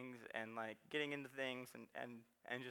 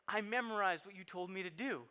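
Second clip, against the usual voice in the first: lightly voiced boy, higher and proud; a hint of a kid reciting for dad; proud little boy; 5 semitones higher, 4 dB louder than usual. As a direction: say it like a toddler showing off. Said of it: "He's like".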